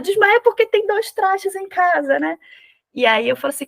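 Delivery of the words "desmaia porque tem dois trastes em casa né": higher, slow, alarmed burst; voicing a panicked intern to his supervisor, mock desperate; desperate outburst. Hammed-up desperate exclamation. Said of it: "Aí ele fica desesperado".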